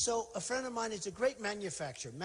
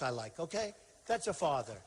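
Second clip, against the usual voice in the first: ordinary talk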